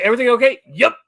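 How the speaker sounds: loud, high-pitched acted question and curt reply; high, hurried exchange. Re-enacting running past Josh in fright, loud high exchange. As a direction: call it out as you run past.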